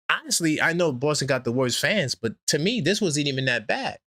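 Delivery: usual speaking voice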